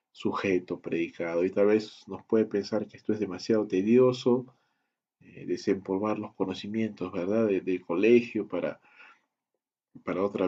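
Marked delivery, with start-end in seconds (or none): none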